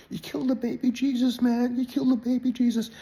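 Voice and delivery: high, screeching hysterical accusation; hysterical drunk friend. Retold or imitated. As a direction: wail it like a panicked drunk. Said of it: "Chuck is hysterical".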